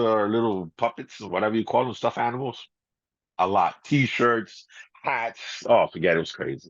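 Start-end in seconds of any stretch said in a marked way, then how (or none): none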